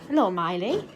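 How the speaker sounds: normal talking voice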